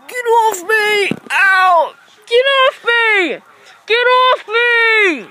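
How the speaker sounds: slow, drawn-out loud yell; yelling at the turtle to let go; struggling yell. Acted. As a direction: shove the thing away with your voice.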